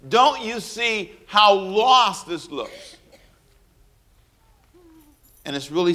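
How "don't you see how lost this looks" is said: slow, high, disbelieving question; mock-stunned slow plea; incredulous. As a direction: draw it out in disbelief. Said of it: "stand there and say".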